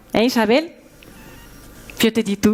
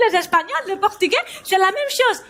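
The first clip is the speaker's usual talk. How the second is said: normal talking voice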